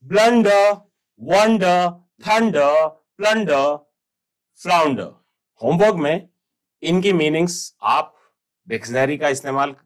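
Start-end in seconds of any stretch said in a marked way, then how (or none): 0.0-5.1 ब्लंडर, वंडर, थंडर, प्लंडर, फ्लाउंडर said loud, each ending in uh with no r; loud class drill, all r sounds silent. Shown as a demonstration. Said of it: "R is silent".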